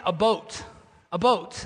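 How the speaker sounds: imitated Wisconsin "about", said like "a boat"; Wisconsin-accented word with a long o. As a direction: mimic a Wisconsin friend's rounded vowel. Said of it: "very similar today with accents".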